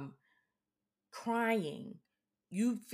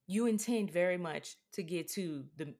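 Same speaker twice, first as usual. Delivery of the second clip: ordinary talk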